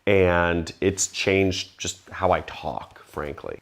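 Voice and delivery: ordinary talk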